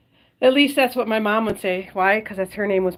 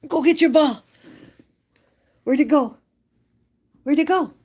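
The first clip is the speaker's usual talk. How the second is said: raised, louder play voice to a dog; higher, louder pet-owner voice; dog talk; 6 semitones higher, 6 dB louder than usual. Real, not acted. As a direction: call playfully to your dog, pitched up. Talking to her dog at play.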